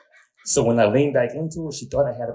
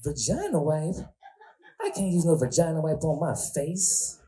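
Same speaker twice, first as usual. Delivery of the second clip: put-on high, appalled girl voice; higher, shocked sweet girl; 6 semitones higher than usual. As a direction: recoil in a high girly voice. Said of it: "sweet girl's voice".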